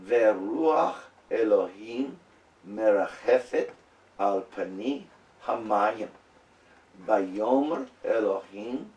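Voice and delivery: Hebrew read word by word, slowly, with pauses between; slow careful Hebrew reading; slow syllable reading. Shown as a demonstration. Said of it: "I'm gonna try to go slow".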